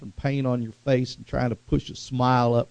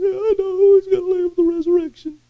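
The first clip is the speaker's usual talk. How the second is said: very high, louder mock-female wail; imitated Martha; 4 dB louder than usual. Martha answers Jesus about her brother, very high imitating voice. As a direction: squeal it as an exasperated woman. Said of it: "She goes".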